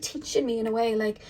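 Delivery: usual speaking voice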